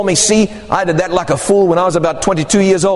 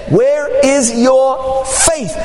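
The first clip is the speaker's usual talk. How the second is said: slow, deliberate question; slow rebuke; 4 semitones higher, much slower than usual. Retold or imitated. Jesus to frightened disciples, slow deliberate question. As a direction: stretch it out, pointed.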